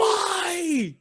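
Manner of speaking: very high cry of why; exasperated disbelief. Real, not acted. Cohost shouting at the absurd standoff, exasperated. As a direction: throw up your hands and shout it.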